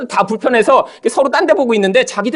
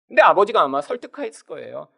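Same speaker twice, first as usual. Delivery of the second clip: usual speaking voice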